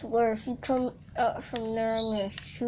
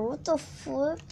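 ordinary delivery

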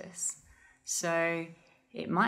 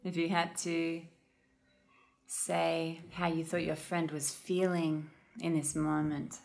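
calm, unhurried meditation guide with long pauses; guided meditation. Real, not acted. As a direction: gently guide listeners into noticing their breath.

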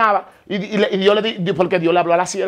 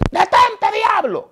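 rebuking shout; much slower than usual. Retold or imitated. Preacher at the campaign rebuking the devil in him, high shout. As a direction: cast out the devil with a sharp high cry.

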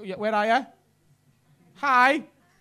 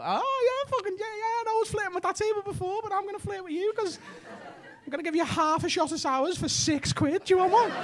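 pretend flirting; 10 semitones higher, 4 dB quieter than usual. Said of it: "pretend like you fancy people".

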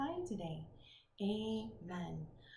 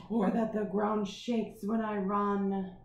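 slow, louder, heavy stomping dinosaur; 6 dB louder, slower than usual. Acted. T. rex tells children the ground shakes, slow heavy dinosaur. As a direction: make the ground shake as you say it.

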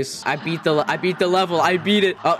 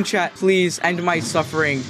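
ordinary talk, nothing marked

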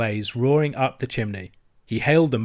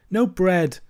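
higher, mournful complaint; dolorous groan; 7 semitones higher than usual. Acted. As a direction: lament the missing bread. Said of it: "groaned the Mole dolorously".

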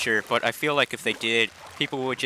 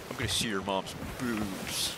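softer, dragged-out kid impression; congested cartoon kid; 3 semitones lower, 8 dB quieter than usual. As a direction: quietly imitate the snotty-nosed kid. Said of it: "and he's like".

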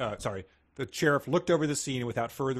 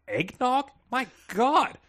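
high-pitched, flustered and overheated; 9 semitones higher, slower than usual. Acted. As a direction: squeak it out, too hot. Acting the sweltering narrator.

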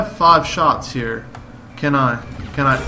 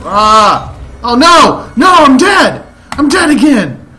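loud, high wail of defeat; anguished yell; 9 semitones higher, 9 dB louder, slower than usual. Real, not acted. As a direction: howl as you die again. Dies in game.

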